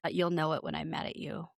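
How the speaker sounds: low, soft icy put-down, mildly imitated; hint of a chilly warning; cold quiet retort. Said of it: "And Sutton says".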